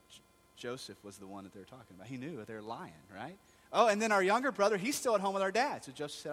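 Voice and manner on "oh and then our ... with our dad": loud, high eager add-on; high loud nervous blurt. Retold or imitated. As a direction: blurt it out hoping to please. Brothers adding the younger brother.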